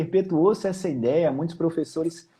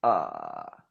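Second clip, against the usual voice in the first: low creaky fry ah; fry sound; 5 dB quieter than usual. Shown as a demonstration. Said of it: "Aí você faz o fry".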